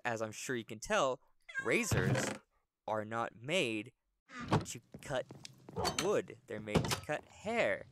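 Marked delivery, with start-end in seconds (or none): none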